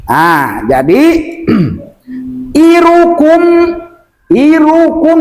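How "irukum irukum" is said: IRUKUM higher, with the kum kept short; short final syllable. Shown as a demonstration. Teacher models the correct length, short ku. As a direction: say irukum without dragging the ku. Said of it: "Jangan dipanjangkan".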